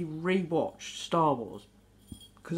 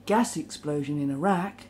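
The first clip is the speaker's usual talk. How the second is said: newsreader headline; 5 semitones lower than usual. Retold or imitated. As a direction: lower mock headline read. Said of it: "the newsreader always sort of like".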